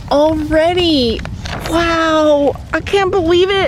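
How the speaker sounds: high, amazed disbelief; excited. Real, not acted. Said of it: "I'm so excited".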